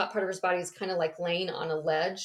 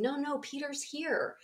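insisting; 7 semitones higher, 4 dB quieter than usual. Retold or imitated. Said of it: "she kept insisting".

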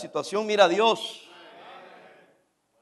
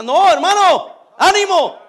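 slow, louder call to take heart; rallying cry; 7 semitones higher, 7 dB louder, much slower than usual. Real, not acted. Pastor urges discouraged members to take heart, slow and loud. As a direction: lift them up with a slow, strong shout.

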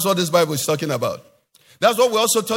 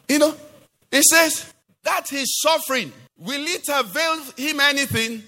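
high, rising preacher's climax; preaching at full force; 9 semitones higher than usual. Acted. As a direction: drive the point home from the pulpit.

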